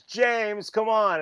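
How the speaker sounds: high, imitated pleading whine; exasperated plea. Kid locked out of the house, imitated exasperated plea. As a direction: beg to be let in.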